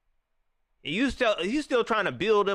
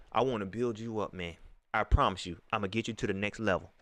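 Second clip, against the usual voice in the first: low, soft mock coach voice; mock coach promise; 11 semitones lower, 5 dB quieter than usual. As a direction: lower and quieter, imitate the coach. Imitating Rhule's pep talk again.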